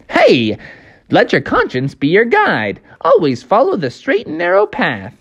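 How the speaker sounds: chirpy high, swooping cartoon voice; high, sing-song little moralizer; Jiminy Cricket. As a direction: preach advice as a tiny cartoon cricket. Jiminy Cricket pops out and advises.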